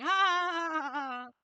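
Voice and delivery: very loud, high, drawn-out "haa!"; mocking jeer. Beings pointing at the listeners, loud mocking jeer. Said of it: "They're pointing and going".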